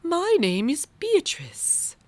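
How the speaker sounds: high and slow, introducing herself; a kind butterfly, unhurried; sweet butterfly. Acted. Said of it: "said the butterfly".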